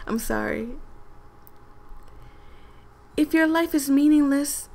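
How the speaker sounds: ordinary talk, nothing marked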